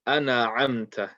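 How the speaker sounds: "ana amta" with an extra "a" after the n; sakin turned into fatha. Shown as a demonstration. Teacher shows the very common mistake, added fatha. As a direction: open the n into "na" before "amta". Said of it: "turning the sakin into a fatha".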